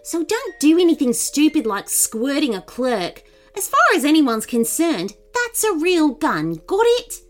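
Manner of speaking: angry. A narrator's reading. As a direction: lay down the plan crossly. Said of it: "Nin replied angrily".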